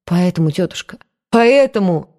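plain reading voice

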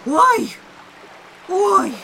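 startled high oh oh oh; frightened oh. Acted. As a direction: gasp it out, shaken awake. Waking from a nightmare.

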